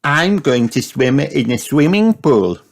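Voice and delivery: put-on high little-girl announcement; excited cry. A narrator's reading. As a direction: brag like a thrilled small child. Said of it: "cried Peppa".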